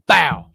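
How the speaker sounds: loud, falling burst of "bow"; imitated bang. Co-host picturing a gunslinging first play, explosive sound effect. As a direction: punch it out like an explosion.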